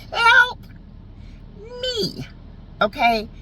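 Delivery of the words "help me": high, mock-pitiful squeak; hammed-up cry for help; crying turtleneck. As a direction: plead for help in a tiny high voice. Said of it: "looked like it was crying".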